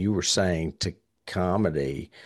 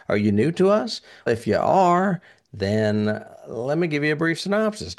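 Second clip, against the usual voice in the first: excited host; 6 semitones higher, 4 dB louder than usual. Real, not acted. Host greeting new listeners.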